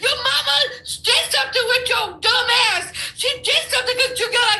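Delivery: high-pitched heated rant; exasperated insult. Real, not acted. Guest berating host she disagrees with, exasperated.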